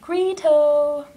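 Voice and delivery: high, loud call of her nickname; lightly voiced husband calling; husband calling her name. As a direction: call out the pet name from the other room. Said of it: "he was like".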